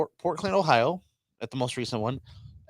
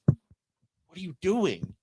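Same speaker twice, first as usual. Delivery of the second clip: very drawn-out, high mock disbelief; baffled stare voiced; 8 semitones higher, much slower than usual. Wrestler to the runner pushing a mic at him, slow high bafflement. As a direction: stretch it out slowly, imitating bewilderment. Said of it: "Bravo's just looking at me like".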